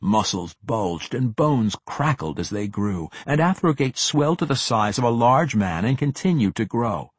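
usual speaking voice

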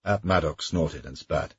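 ordinary delivery

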